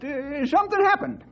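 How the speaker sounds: ordinary delivery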